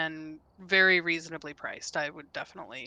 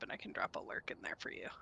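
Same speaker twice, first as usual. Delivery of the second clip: whisper; 14 dB quieter, faster than usual. Real, not acted. Whispering aside.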